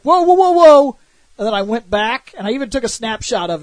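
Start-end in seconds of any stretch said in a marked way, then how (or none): 0.0-1.0 high, loud, startled whoas. Retold or imitated. Walking through Prompto, high loud startled whoas. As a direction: yelp it as if the game just broke. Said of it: "and I was like".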